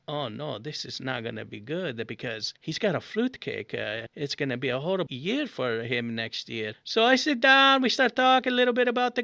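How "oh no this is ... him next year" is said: hushed, much lower aside to himself; low inner dread. Retold or imitated. Guest silently dreading the fruit cake, low and hushed. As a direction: sink into a quiet, ominous grumble.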